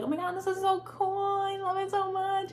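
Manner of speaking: high bubbly mock gush; exaggerated imitation of other people's delight; gushing everyone else. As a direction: play the over-the-moon gift receiver, way up high. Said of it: "They're like".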